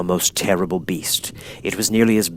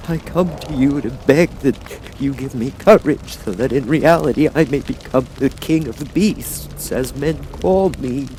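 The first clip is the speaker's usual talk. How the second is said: Cowardly Lion pleading; 7 semitones higher than usual. Acted.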